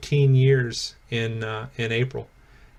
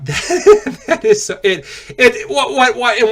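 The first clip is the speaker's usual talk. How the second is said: high giggly stammer through laughter; giggling; 8 semitones higher than usual. Real, not acted. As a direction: laugh it out, can't get the words. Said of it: "why I giggle".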